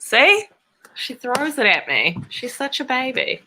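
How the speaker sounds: high, excited voice to a dog; pet talk. Real, not acted. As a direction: coo at the playful dog. Talking to her dog.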